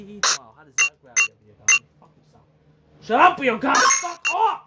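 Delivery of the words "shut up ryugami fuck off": loud, raised shout; angry yell. Real, not acted. Player yelling at Ryugami to back off, angry yell. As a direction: tell him off hard.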